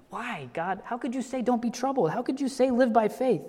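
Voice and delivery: a believer's plaintive cry to God; pleading cry to God. Acted. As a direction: ask God why, lifting high. Cries out the question to God.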